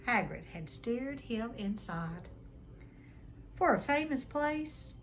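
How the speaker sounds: usual speaking voice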